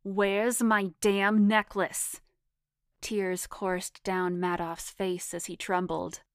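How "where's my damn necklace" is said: slow, louder angry demand; shouting. A narrator's reading. Frank to Foxy, gun at Madoff's head, shouting. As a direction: shout it slowly, hitting each word. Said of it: "and shouted".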